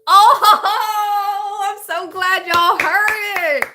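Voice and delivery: high and loud through real laughter; thrilled, laughing out the words; laughing with joy. Real, not acted. Viewers confirm the hawk call, delighted laughing burst.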